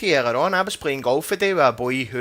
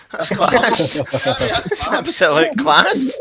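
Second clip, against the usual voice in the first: laughing; 6 semitones higher, 7 dB louder, much slower than usual. Real, not acted.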